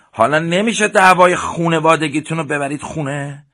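high, loud irritated voice; fed-up complaint. Acted. Yousef complains to the bickering brothers, fed up. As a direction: snap at the brothers to take it home. Yousef annoyed by family fight.

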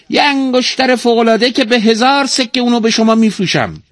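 very high, loud parrot voice; high-pitched, indignant tale-telling bird; talking parrot, aggrieved. A narrator's reading. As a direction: squawk out your complaints as a tattling parrot. Narrator plays the talking parrot.

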